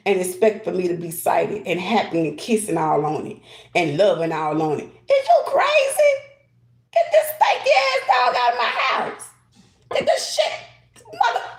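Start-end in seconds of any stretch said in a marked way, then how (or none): 4.9-11.5 outraged shriek. Acted. Acting out someone handed a stinky dog, shrieking in outrage. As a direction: shriek it at whoever brought the dirty dog. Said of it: "That's how a person will react".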